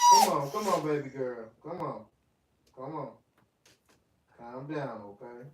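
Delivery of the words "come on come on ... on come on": loud, high coaxing call to the dog. Real, not acted. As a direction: call her over with a burst of volume. Calling the dog.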